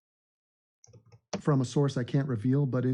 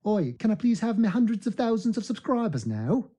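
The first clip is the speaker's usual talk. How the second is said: put-on high, cheeky London-accent plea; 6 semitones higher than usual. Streamer asking for subscribers, put-on London accent. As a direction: call out like a Londoner begging.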